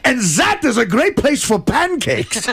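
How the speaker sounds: put-on high, slow, cheery German accent. German guide recommending a pancake place, high cheery German accent. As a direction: switch to a perky German guide, drawn out. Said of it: "that German accent is just really".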